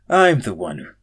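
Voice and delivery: exasperated. Acted. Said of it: "I said with a sweat".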